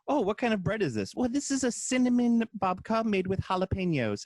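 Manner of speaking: high put-on British baking-show voice; mock British baker. Host acting out British bakers presenting odd bread, high mock voice. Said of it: "of the British Isles in general".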